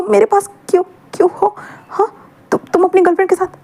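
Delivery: hurt accusation, voice catching; tearful and hurt. Acted. Wing upset, near tears.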